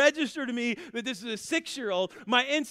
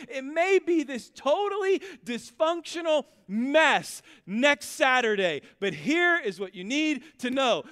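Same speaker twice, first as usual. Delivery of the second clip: slow, louder pulpit cadence building; preaching at full force; 2 semitones higher, 5 dB louder, slower than usual. Acted. Pastor urging his church through a messy Christmas, preaching. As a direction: drive each phrase home from the pulpit.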